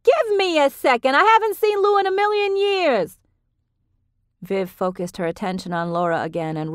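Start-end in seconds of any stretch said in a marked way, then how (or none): 0.0-3.2 high, loud call to the next room; shouting back. A narrator's reading. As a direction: keep calling out to Frankie. Viv still shouting back.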